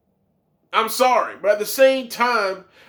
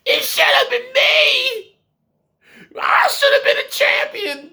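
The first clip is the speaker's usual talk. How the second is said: mock tantrum, whining that it should have been him; hammed-up crybaby shout, pitched way up; whiny hissy fit. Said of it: "hissy fit going".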